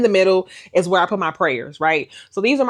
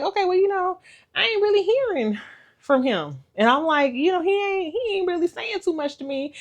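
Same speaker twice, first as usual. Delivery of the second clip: much higher, fretful voice; mock worried musing; 13 semitones higher than usual. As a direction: fret aloud, pitched way up. Retelling her own worry.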